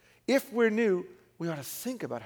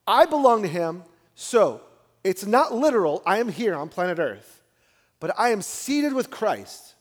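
preaching at full force; 4 semitones higher, 7 dB louder than usual. Acted.

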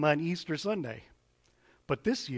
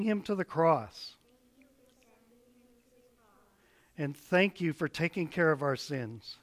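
put-on children's prayer; 3 dB quieter than usual. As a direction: pray gently, line by line. Leads kids in repeat-after-me prayer.

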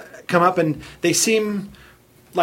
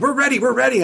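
eager; 8 semitones higher than usual. Retold or imitated. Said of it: "they both said".

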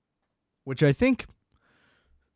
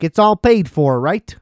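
louder, mock Chicago-guy question; imitated Chicago caller; 4 semitones higher, 6 dB louder than usual. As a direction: imitate the Chicago delivery driver checking in. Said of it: "caught off guard by his voice".

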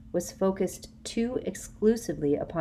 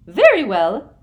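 high, sugary and loud; a prim, simpering official; Umbridge, firmly deciding; Umbridge's sweet voice; 4 semitones higher, 15 dB louder than usual. A narrator's reading. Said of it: "she said".

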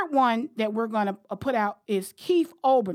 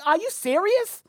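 incredulous; 8 semitones higher than usual. Real, not acted. Reacting to Portnoy clip.

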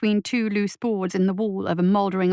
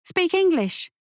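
put-on higher young boy, impatient; irritated boy; 9 semitones higher, slower than usual. A narrator's reading. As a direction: brush it off like a cocky kid. Lasky voiced as boy.

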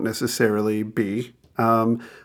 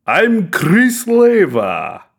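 slow, dramatic Count Dracula drawl; bad vampire voice. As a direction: ham it up like a campy vampire host. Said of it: "I'm totally finished with the vampire voice".

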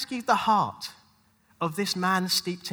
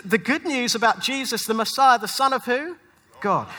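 ordinary talk

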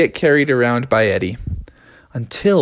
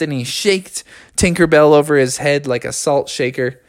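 ordinary delivery

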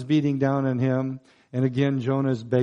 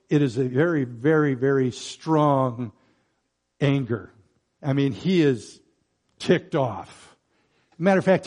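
ordinary delivery

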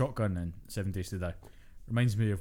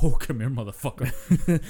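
slow, laughing, louder taunt at an enemy; gloating taunt; 3 semitones higher, 7 dB louder, slower than usual. Retold or imitated. Gamer taunting a powered-up enemy, slow laughing gloat. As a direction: savour the kill as you call it over. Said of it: "you're just like".

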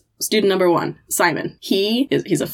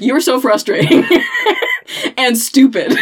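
a teasing voice breaking into laughter, louder; laughing mock scorn; 6 semitones higher, 4 dB louder than usual. Teacher voicing the student's smug look, laughing. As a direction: voice the kid's look while cracking up. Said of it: "the look on her face was like".

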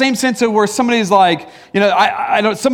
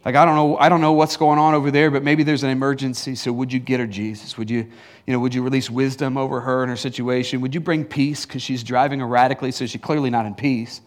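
gentle prayer; 9 semitones lower, 4 dB quieter than usual. Pretend. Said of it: "Instead, I go".

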